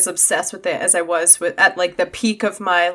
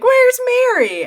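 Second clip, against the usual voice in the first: high, held, sing-song call of "Where's Merry?"; alarmed search; 4 dB louder than usual. Pretend. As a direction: call it out high and long like calling a lost friend. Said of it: "they're gonna be like".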